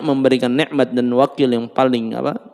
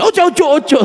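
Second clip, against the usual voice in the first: higher, louder pleading; pleading wife; 14 semitones higher, 4 dB louder, faster than usual. Acted. Wife begs husband not to remarry, higher and louder. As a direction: beg him not to go.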